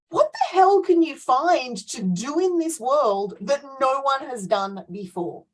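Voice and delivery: mock disbelief. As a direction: scoff at the idea like it's absurd. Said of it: "I'm like".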